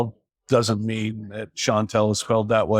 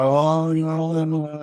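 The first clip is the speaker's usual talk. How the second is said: suspect's excuse; 7 semitones higher, much slower than usual. Retold or imitated. Suspect caught with toothpicks.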